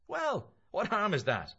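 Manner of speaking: high, loud protest; loud, blustering challenge; defensive Mulligan. Acted. Mulligan's part in dialogue.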